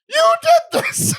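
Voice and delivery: high, slow, outraged squeal; mock accusation. Blaming God for the broken sound, mock outraged squeal. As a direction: drag it out in fake fury. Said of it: "I'm like".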